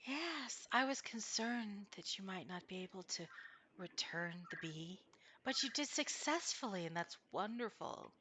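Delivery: soft, otherworldly unicorn voice; a kindly unicorn speaking in the mind; gentle unicorn voice. Acted.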